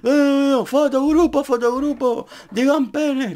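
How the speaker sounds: high, loud group-photo call; the rowdy mate rounding everyone up; pushy friend. Retold or imitated. Friend calling the group for a photo, high and loud. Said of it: "al típico que es".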